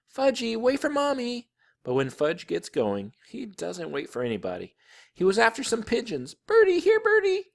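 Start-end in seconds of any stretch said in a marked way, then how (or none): 0.1-1.5 high calling-out mother's voice; hollering mother. A narrator's reading. 6.3-7.5 put-on very high, loud little-boy call; toddler calling. A narrator's reading. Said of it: "he called".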